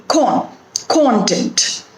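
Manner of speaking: con alone, then content as CON-tent; stress on first syllable. Shown as a demonstration. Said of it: "the stress is on the first syllable".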